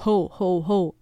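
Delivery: lightly roughened Santa laugh-call; father's slightly gruff Santa act; rough voice. A narrator's reading. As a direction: put on a rough Father Christmas voice. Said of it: "sagt mit rauer Stimme".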